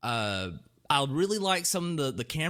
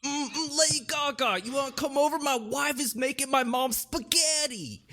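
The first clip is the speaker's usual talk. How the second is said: 1 New York Italian; 9 semitones higher than usual. Acted. Keeps Italian character voice.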